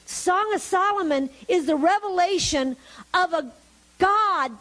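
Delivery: rising, fervent sermon voice, pressing each point home; preaching. Acted. Preacher urging the congregation to see God's need, fervent. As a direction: preach it, building toward the big reveal.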